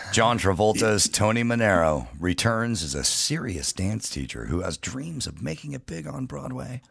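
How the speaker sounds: ordinary delivery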